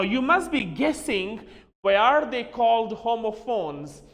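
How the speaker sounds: ordinary talk, nothing marked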